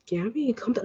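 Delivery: hushed mock calming voice; imitating onlookers. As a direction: murmur it as someone trying to settle her. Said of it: "And people l-, look at me like".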